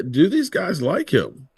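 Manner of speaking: ordinary talk, nothing marked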